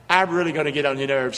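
ordinary delivery

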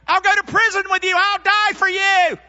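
high, shouted pledge, slowing at the end; boastful Peter. Acted. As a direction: proclaim loyalty at full blast. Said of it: "Here's Peter, full of Peter".

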